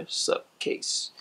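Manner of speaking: breathy whisper of the brand name Supcase. Real, not acted. Reads brand name again, whispered.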